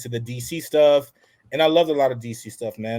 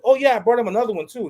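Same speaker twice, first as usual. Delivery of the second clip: put-on eager kid; 7 semitones higher, 4 dB louder, faster than usual. Kid eagerly answering mom.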